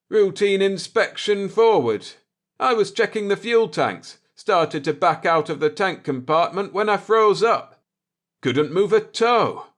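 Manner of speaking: high, loud urgent report; navigator explaining. Acted. Jordan telling Akers where he was, high and loud. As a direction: rattle off what happened to him.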